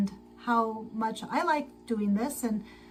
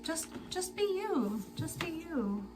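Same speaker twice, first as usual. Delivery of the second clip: higher, softer quote of well-meaning people; lightly voiced advice-givers; 6 semitones higher, 6 dB quieter than usual. People are like, just be yourself, lightly imitated advice-givers. Quoting what people advise her.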